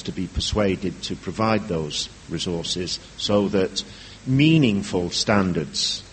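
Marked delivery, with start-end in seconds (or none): 4.3-6.0 "meaningful" stretched slowly and pitched up; emphasis on "meaningful". Real, not acted. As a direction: lean hard on "meaningful", drawing it out. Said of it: "I emphasize the word meaningful".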